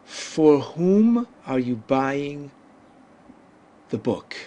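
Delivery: slow teacher model sentence; slow and deliberate. Shown as a demonstration. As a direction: lay each word down slowly for learners. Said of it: "suppose I now wanted to say".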